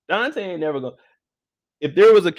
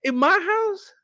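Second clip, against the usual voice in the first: shrieking high in my house; much higher outraged squeal; outraged disbelief; slower than usual. Real, not acted. Outraged at cheating in his house.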